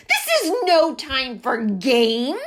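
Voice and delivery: loud indignant yell. A narrator's reading. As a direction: shout it down at her. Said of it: "yelled Goose".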